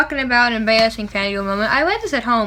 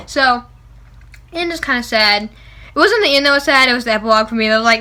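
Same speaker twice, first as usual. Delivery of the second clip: ordinary delivery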